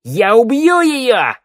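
high, drawn-out threatening shout; full-hall scream. A narrator's reading. Shnyryala threatens her hostage's life before all, screaming. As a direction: scream it so the whole hall hears. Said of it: "закричала на весь зал".

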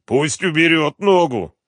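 high, loud plea in pain; pained moan. A narrator's reading. Captive whose hand is being crushed, moaning. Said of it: "простонал артист".

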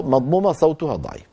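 ordinary talk, nothing marked

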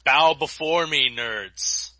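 slow, booming villain proclamation; dark lord. Pretend. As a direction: lord it over the nerds, slow and loud. Guest enters as mock villain.

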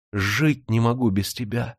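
ordinary delivery, nothing marked

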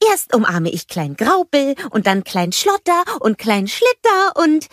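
high cheerful snowman voice; eager snowman. A narrator's reading. Olaf planning hugs for his brothers, eager. Olaf lists the little ones.

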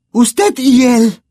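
Hermione accusing. A narrator's reading. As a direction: point at him and stammer the word.

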